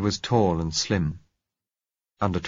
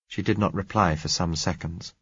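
ordinary delivery

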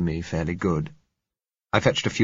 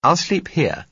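high, bright-pitched woman's line; young woman's voice; 10 semitones higher, 5 dB louder, slower than usual. Acted.

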